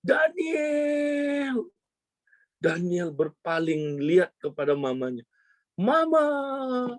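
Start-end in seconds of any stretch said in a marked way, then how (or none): 0.0-1.7 very high, long-drawn cry of the name; acted mother's piercing call; imitated yelling. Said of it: "Dia teriak". 5.7-6.9 put-on high, long-drawn child's call; imitated reunion cry, pitched way up; acted crying out. Child cries out to mother.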